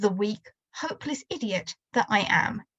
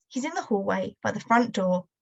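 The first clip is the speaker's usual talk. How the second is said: ordinary delivery, nothing marked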